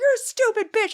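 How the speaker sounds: high, loud mocking yell; high mock insult. The driver insults her, mocked in a high yell. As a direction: hurl it like the rude man. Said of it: "He's like".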